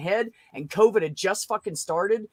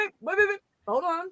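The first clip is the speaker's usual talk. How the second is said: urgent interruption; 10 semitones higher, 4 dB quieter than usual. Real, not acted. Cutting into a heated argument.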